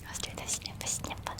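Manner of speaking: breathy close-mic whisper trigger. Acted. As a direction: whisper each word right into the mic. Trigger words, close soft whisper.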